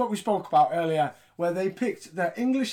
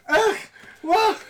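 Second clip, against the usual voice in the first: disgusted groan; 5 dB louder than usual. Real, not acted. Reacting to cringeworthy fan clip.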